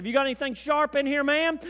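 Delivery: fast, high, brusque security officer; harsh official tone. Retold or imitated. Airport guard interrogating the preacher's wife, brusque. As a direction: bark the question like a suspicious guard. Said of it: "in that tone of voice".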